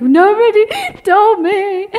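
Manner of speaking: high, loud, drawn-out mock wail; mock crying. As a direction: wail it like a hammed-up tearful complaint. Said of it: "normally we're crying at this point".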